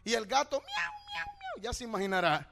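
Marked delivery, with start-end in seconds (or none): 0.6-1.3 high, wailing mock meow of a hurt cat. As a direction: yowl it like a cat in pain. Preacher voices the hurt cat. (maullido agudo y lastimero de gato)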